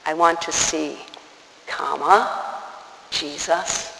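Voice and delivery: "I want to see", a clear pause, then soft "Jesus"; pause at the comma. Shown as a demonstration. Preacher showing the beggar addresses Jesus, with a marked pause before "Jesus". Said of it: "That's important".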